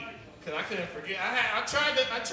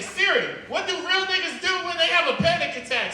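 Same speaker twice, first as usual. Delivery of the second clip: panicked yell at Siri; 10 semitones higher, 8 dB louder than usual. Retold or imitated. Panicked comic asks Siri.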